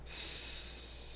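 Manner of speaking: quiet unvoiced hiss; soft upset hiss. A narrator's reading. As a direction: hiss in frustration. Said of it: "said Gollum".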